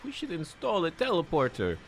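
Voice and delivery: high, lightly put-on Russian accent; a quick joking Russian-accented NPC impression; mock Russian accent. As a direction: imitate the quest giver in a loose Russian accent. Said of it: "she's kind of weirdly Russian".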